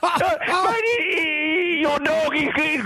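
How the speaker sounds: high, slow, hammed-up blubbering; mock sobbing laugh. Caller telling Gus about his dog, hammed-up sobbing laugh. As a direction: fake a tearful breakdown through laughter.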